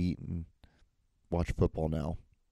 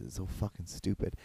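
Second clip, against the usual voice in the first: usual speaking voice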